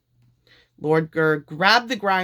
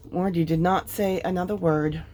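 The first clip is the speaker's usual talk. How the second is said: ordinary delivery, nothing marked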